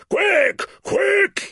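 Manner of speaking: high, loud, urgent shout. A narrator's reading. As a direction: yell it out in alarm. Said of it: "he shouted".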